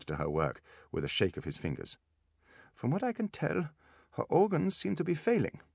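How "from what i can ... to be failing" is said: put-on high-pitched German-accented diagnosis; German specialist, pitched well up; German doctor. A narrator's reading. Same German doctor continues diagnosis.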